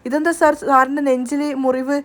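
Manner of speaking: ordinary delivery, nothing marked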